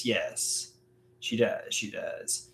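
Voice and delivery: soft, unvoiced aside; whisper. Real, not acted. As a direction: breathe the agreement almost to yourself. Agreeing under his breath.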